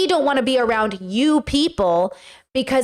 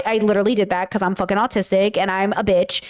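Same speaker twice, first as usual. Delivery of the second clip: usual speaking voice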